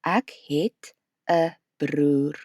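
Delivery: slow, careful "Ek het 'n broer" with each word separate; teacher leading a slow repeat; slow repeat-after-me. Shown as a demonstration. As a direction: draw it out so learners can say it along. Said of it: "Say it with me".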